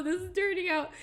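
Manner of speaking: dismayed. Real, not acted. Dismay at drawing going wrong.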